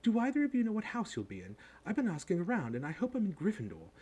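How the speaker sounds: high, brisk schoolgirl voice; bossy girl. A narrator's reading. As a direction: quiz them eagerly like a know-it-all. Plays Hermione in story.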